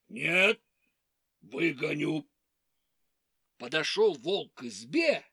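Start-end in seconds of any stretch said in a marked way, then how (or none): 0.1-2.3 low wolf voice. Acted. Wolf insists.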